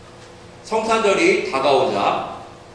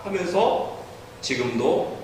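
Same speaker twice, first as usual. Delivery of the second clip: ordinary talk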